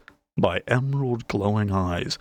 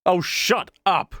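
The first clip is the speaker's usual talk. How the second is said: roaring back; 10 semitones higher than usual. A narrator's reading. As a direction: bite back at the heckler. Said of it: "Callie roared back".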